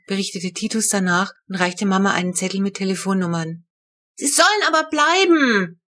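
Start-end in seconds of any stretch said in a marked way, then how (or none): 4.1-5.8 put-on high, loud defiant child's shout; a little girl stamping her foot in protest; child yelling. A narrator's reading. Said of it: "brüllte die Kurze".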